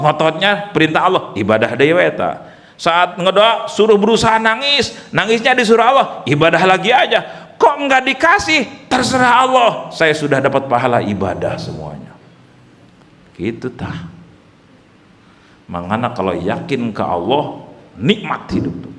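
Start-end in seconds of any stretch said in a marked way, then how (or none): none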